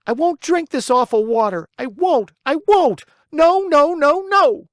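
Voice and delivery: very high, loud wailing refusal, building; a child's screaming tantrum; crying tantrum. A narrator's reading. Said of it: "bursting out crying".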